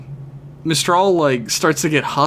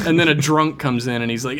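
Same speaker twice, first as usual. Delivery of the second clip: usual speaking voice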